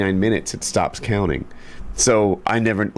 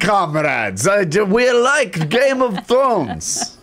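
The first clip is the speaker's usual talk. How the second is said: slow, high mock Russian accent; hammed-up Russian accent, drawn out; 11 semitones higher, much slower than usual. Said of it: "[name], do a Russian accent".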